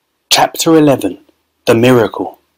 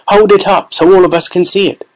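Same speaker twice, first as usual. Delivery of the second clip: shout; 7 semitones higher than usual. A narrator's reading. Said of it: "somebody shouted".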